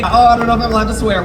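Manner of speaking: ordinary talk, nothing marked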